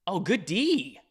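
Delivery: ordinary talk, nothing marked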